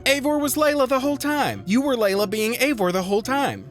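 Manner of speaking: hammed-up disbelief; mock shock. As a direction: gasp it out like a twist reveal. Mock shocked plot reveal.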